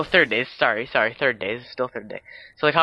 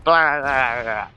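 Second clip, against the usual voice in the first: garbled "blah-blah" noise, louder at the start; imitated head wobbling; 3 dB louder, slower than usual. Retelling a head wobbling in fast motion, imitated babbling noise. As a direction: burst of mock wobbling babble that tails off. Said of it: "his head starts wobbling everywhere. It's like".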